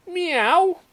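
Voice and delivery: loud, high rising mew; protesting kitten meow. A narrator's reading. Kitten given an awful perm, high protesting meow. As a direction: meow in high-pitched dismay.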